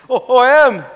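high, loud mock sob; sobbed, acted. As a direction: wail it as a pretend sob. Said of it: "sobbed Stefan".

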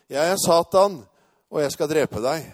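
roping. Retold or imitated. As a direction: imitate the man calling out his threat. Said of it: "Så han fortsatte å rope der".

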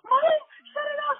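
loud, very high-pitched shriek; squealed frantic retold plea; panicked. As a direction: act out begging mom in sheer panic. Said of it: "I got completely stupid".

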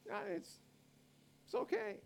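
high, sing-song mock of "okay"; mock the casual permission in a falsetto. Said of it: "let's just, for argument's sake".

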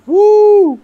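high, loud cheer. Real, not acted. Friend cheering for his follower milestone, whooping. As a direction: whoop it out in celebration.

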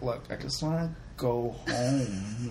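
slow, soft, tired sigh of a line; weary Dallas. Retold or imitated. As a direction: drag it out, done with everything. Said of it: "Dallas is just like".

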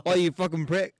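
loud, high British insult; British accent. Acted. Joking abuse among friends, British accent. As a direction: heckle in a put-on British accent. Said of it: "what's your best British accent?".

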